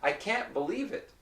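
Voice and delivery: "can't" with a glottal stop, no T. Shown as a demonstration. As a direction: stop the air in "can't" before "believe". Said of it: "if I say".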